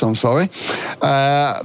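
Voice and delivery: normal talking voice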